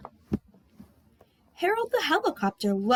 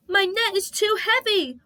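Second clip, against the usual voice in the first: higher, louder wail; 9 semitones higher, 4 dB louder than usual. Acted. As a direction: wail in panic. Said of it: "wailed Harold".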